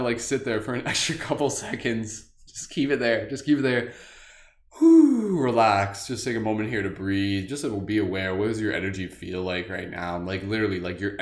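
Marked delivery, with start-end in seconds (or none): 4.8-5.4 relieved whew. Real, not acted. After long laugh.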